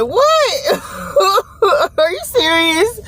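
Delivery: high, laughing what; delighted shock at being asked back; shocked disbelief. Retold or imitated. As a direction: squeal it in happy disbelief. Shocked by an offer.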